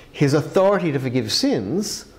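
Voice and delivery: usual speaking voice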